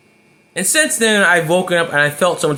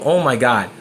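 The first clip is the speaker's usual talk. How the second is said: usual speaking voice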